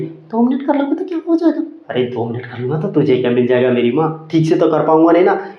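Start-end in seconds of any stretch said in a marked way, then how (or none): 0.0-1.6 high-pitched mimic of a wheedling girlfriend; mock girlfriend. Girlfriend to busy boyfriend on the phone, high mock pleading. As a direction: put on a much higher girlfriend voice. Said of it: "कहेगी".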